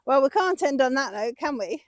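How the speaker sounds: ordinary talk, nothing marked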